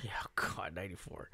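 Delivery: whispered aside to himself; whispering. Real, not acted. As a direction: breathe it out, embarrassed. Embarrassed about his age.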